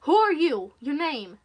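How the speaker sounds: very loud, sharp questioning; angry caller demanding a name; irritated interrogation. Acted. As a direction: demand an answer forcefully.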